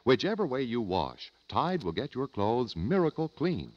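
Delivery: normal talking voice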